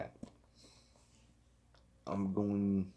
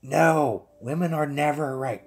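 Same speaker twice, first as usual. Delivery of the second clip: loud, higher joking protest; mock outburst; 6 semitones higher, 7 dB louder than usual. Streamer teasing about partner being right, mock outburst. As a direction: cry out in playful indignation.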